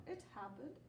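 ordinary delivery

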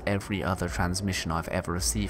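calm reading of a log; story narration. Acted. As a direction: read it like a captain's journal. Reads game log aloud.

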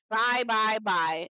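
loud, drawn-out send-off; emphatic, dismissive byes; dismissive goodbye. Acted. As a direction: wave them off loudly. Waving off the apology, dismissive.

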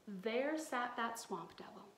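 ordinary delivery, nothing marked

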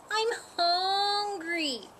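high, slow, plaintive kitten voice; small high storybook kitten; tiny kitten. A narrator's reading. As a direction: whine it slowly like a hungry kitten. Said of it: "A tiny kitten".

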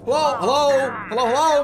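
frantic high yelling; panicked shouted hellos; screaming in fear. Real, not acted. Frantic hellos to game creature.

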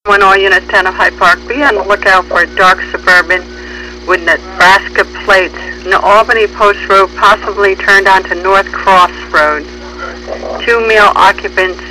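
usual speaking voice

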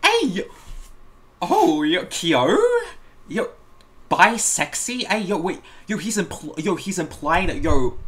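loud, high shocked shout. Real, not acted. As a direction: call out in disbelief. Shocked reaction to joke.

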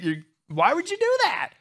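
high, far-off disbelieving cry; squeaky mock disbelief; incredulous question. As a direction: act baffled at the fool. Mocking a man with sagging pants.

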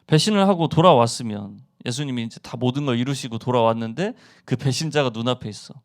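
ordinary delivery

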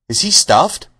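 lion taken aback, pitch jumping up; surprised. A narrator's reading. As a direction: ask it in sheer surprise. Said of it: "asked the lion in surprise".

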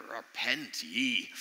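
slow, drawn-out Northern Irish street-preacher "Repent ye"; hammed-up hellfire call in a Northern Irish accent. Pastor mocks street preachers for his congregation, slow mock Northern Irish preacher cry. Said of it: "probably with a Northern Irish accent".

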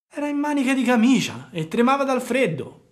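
a fox baiting a puppet; sly fox. A narrator's reading. Fox talks to Pinocchio. (la volpe furba, voce molto più alta e forte)

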